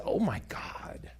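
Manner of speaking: breathless hushed whisper, slow; a woman whispering in awe at her shirt; awed whisper. Retold or imitated. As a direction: whisper it like something sacred.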